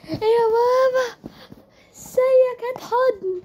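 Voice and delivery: put-on high slow sweet kid's voice; little kid asking for a hug; high slow child's greeting; child greeting father.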